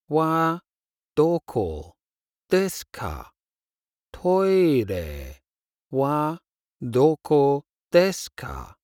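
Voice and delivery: normal talking voice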